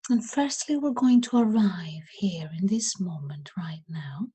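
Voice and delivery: unhurried, softening as the questions go on; guided meditation. Acted.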